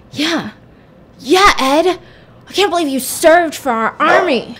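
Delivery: high-pitched, hammed-up enthusiasm; amped up. Ashley fired up by Ed's army speech, amped up. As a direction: act out someone getting all fired up. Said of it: "getting amped up".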